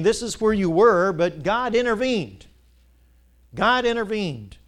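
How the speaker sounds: loud, slow proclamation, leaning on God intervened; preaching at full force. Acted. Preacher proclaiming to the congregation, slow and loud. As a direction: declare it to the whole church.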